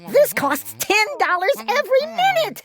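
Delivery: high scolding voice; frantic complaint; yelling. A narrator's reading. Sister scolding the glunk about the cost, high yell. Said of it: "my sister yelled".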